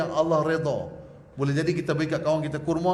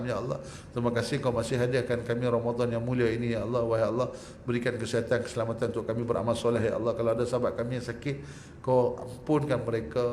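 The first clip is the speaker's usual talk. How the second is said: low, soft, earnest prayer voice; pleading prayer chant; closing prayer; 7 semitones lower, 5 dB quieter than usual. Acted. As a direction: lead the congregation in humble supplication.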